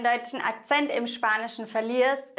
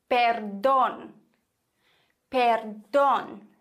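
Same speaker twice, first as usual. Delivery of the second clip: perdón with a long, punched DÓN; stress on second syllable; much slower than usual. Shown as a demonstration. Teacher models perdón for learners, last syllable stressed. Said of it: "unbedingt diese Silbe betonen".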